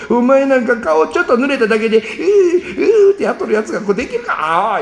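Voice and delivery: high, laughing mockery with fake whimpers; teasing mock. Father teases son who fears getting his face wet. Father mocks child's fear of water.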